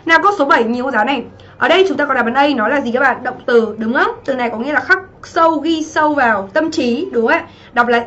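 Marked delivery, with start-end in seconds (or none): none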